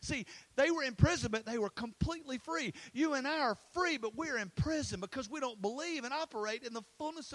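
high, urgent preacher voice; impassioned preaching. Real, not acted. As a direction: drive the point home to the congregation. Presses the point on the congregation.

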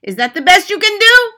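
high, loud scornful challenge; Grand High Witch crying out. A narrator's reading. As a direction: shriek the question at them. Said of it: "the Grand High Witch cried out".

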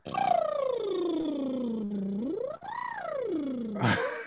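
long high laugh. Real, not acted. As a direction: burst out laughing at the slip. Slip of the tongue.

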